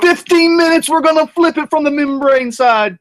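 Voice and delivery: high, loud burst of excitement; happy yelp over the timer; excited. Real, not acted. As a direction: announce it with a jolt of glee. Excited after timer works.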